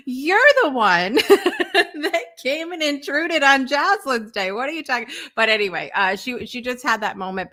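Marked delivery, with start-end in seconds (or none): 0.1-5.1 laughing. Retold or imitated. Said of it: "I'm like".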